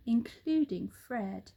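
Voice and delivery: ordinary delivery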